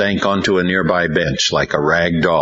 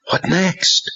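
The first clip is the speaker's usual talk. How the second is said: asking the Lord. A narrator's reading. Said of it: "he asked the Lord".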